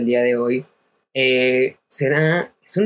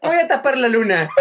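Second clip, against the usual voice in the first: laughing; 8 semitones higher than usual. Real, not acted. Laughing while joking about moon.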